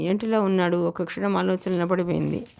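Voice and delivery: ordinary delivery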